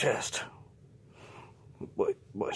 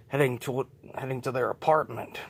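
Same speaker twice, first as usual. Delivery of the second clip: ordinary delivery, nothing marked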